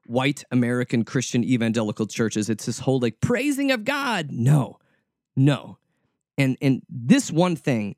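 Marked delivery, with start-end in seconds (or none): 3.2-4.3 high, slow, sing-song praising of God; mock praise. Preacher mocking evangelical praise, high and slow. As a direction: lampoon churchy praise way up high. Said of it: "mocking church praise".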